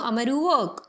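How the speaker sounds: "Amaru work" with the sentence crushed to two words; very short slang. Shown as a demonstration. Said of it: "इस statement को और short करना है". (बहुत छोटा कर के बोलें)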